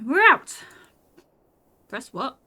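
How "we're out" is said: very loud, high cry of triumph; triumphant shout. Acted. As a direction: yell it as you burst out. Bursting through the door.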